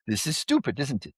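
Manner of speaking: put-on playful Indian-accented guru scolding; mock Indian accent. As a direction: tease in a light Indian accent. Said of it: "you're so good at Indian accents".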